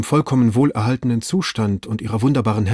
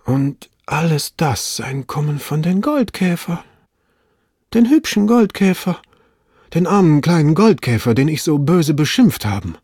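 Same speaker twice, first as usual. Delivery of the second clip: overwhelmed self-talk, pitched up and swelling; exclaiming to himself; 6 semitones higher, 4 dB louder than usual. A narrator's reading. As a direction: cry it out in a high character voice, as if talking to yourself. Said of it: "rief er aus, als sei er in einem Selbstgespräch".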